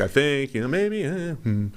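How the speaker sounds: high, hesitant hedging; unsure meme voice. Acted. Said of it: "like that whole meme".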